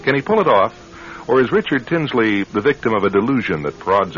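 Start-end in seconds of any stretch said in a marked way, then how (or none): none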